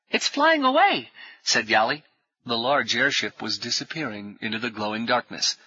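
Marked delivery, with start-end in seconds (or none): 0.1-1.1 put-on high, raised young voice; excited boy's call. A narrator's reading. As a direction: call it out high, amazed at the sight. Excited boy calls out.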